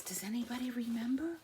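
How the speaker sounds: playful whispered question. Real, not acted. Whispered aside to children.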